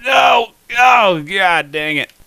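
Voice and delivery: high, loud, drawn-out cry of dismay; frustrated shout. Real, not acted. Gamer failing after trying again, frustrated shout. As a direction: shout it out slowly in exasperation. Loses the level at last moment.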